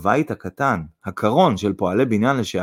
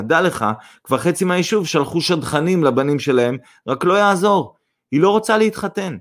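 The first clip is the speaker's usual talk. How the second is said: merry, higher-pitched chatter; cheerful; 6 semitones higher than usual. A narrator's reading. Mother tells son of failed matchmakers, cheerful. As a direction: tease merrily, pitch climbing. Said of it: "בעליזות המשיכה זינה".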